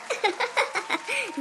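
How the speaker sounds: soft, high scheming laugh; quiet villainous giggle; gloating chuckle. Acted. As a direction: chuckle to yourself over a working plot.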